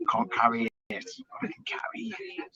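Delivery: low, hushed hobbit voice, partly whispered; quietly devoted companion, slipping into a whisper; Sam Gamgee. Acted. Quoting Sam to Frodo at the end, softly acted. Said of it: "Sam Gamgee's voice".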